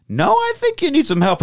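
high, insisting friend. Retold or imitated. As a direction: push back firmly. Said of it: "He's like".

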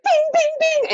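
high-pitched ringing "bing" noises; imitated clanging knives. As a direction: imitate metal pinging off in quick succession. Imitates butter knives flying off treadmill.

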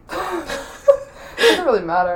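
slow, laugh-broken giving up. Real, not acted. Giving up on the guessing game, laughing. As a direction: let it dissolve into laughter.

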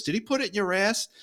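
high-pitched retold teasing question; cheeky jab at the old lady; smart-ass partner. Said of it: "my partner, who's a smart ass".

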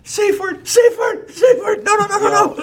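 high frantic yelling; panicked shouting. Pretend. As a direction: yell the safe word in panic. Acting out panicked safe word.

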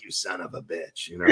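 muttered low aside as the cat; cat's inner voice. Retold or imitated. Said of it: "the cat's just looking at me like".

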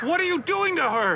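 high, pained yell; anguished shout. Acted. Father watching his wife's ghost hurt, anguished shout. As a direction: demand an answer, distraught.

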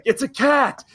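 high, loud imitated outburst; freaking out. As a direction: yell it like the exasperated passenger, laugh creeping in. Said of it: "he's, like, freaking out".

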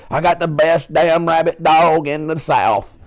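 a trucker boasting over CB radio; trucker brag. Retold or imitated. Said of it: "these guys are like".

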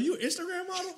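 very high, laughing impression; starstruck guy. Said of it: "he's like".

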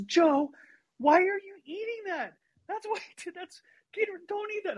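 high, startled bossy-sister voice; big sister suddenly realizing the chili is inedible, pitch up; shocked big sister. Retold or imitated. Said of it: "She says".

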